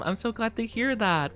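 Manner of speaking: ordinary delivery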